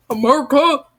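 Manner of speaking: hammed-up American cry; mock American accent. Reader joking after a failed French name, mock American accent. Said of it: "I'm just gonna speak American".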